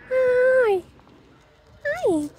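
high cooing voice to a cat. Real, not acted. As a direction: coo at the animal in a high voice. Greeting an animal.

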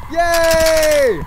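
loud happy yay; bursting with delight; excited cheer. Real, not acted. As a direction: cheer at the find. Excited cheer at finding eggs.

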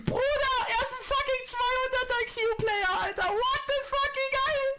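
very high, drawn-out ecstatic cries; ecstatic screaming. Real, not acted. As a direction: squeal in disbelieving joy. Ecstatic over enemy player.

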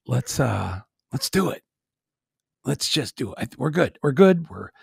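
usual speaking voice

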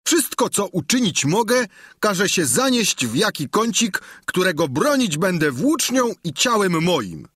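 a frightened squire protesting; Sancho complaining. A narrator's reading. Sancho voiced in narration. (Sancho żali się wyższym głosem)

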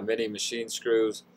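ordinary talk, nothing marked

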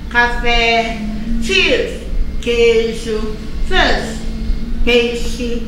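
normal talking voice

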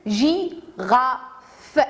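"girafe" split into gi, ra, fe; syllable by syllable. Shown as a demonstration. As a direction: separate "girafe" into three beats with pauses. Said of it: "Combien y a-t-il de syllabes".

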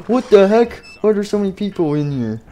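high, loud startled cry; shocked Freddy. Acted. Freddy shocked to find people in his pizzeria. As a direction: burst out in shock at the crowd.

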